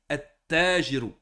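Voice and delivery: "At-Tajiru" drawn out with a heavy double t; marked shadda. Shown as a demonstration. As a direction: lean slowly on the doubled t of "At-Tajiru". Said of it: "Les shadda, il faut bien les marquer". (« At-Tajiru » lent, t redoublé et appuyé)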